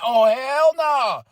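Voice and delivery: mock crowd. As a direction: imitate a disapproving crowd. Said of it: "That crowd was like".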